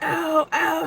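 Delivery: high pained whimpers; whiny hurt man. Retold or imitated. Thief moaning after the punch. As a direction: whimper like you're bleeding.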